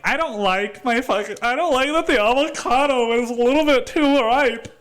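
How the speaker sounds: mock whiny customer, very high and half sobbing; high, crying, laughing whine about the avocado. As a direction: wail it like a spoiled diner on the verge of tears. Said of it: "you're just like".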